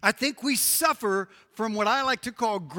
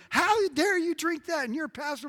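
mock yelling; 8 semitones higher than usual. Preacher imitates someone scolding him over Diet Coke, mock outraged. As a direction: scold in a raised, outraged pitch. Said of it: "somebody yelling at me going".

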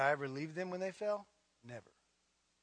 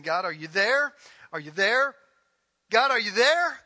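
anxious call to God; 5 semitones higher, 13 dB louder than usual. Retold or imitated. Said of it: "You're saying".

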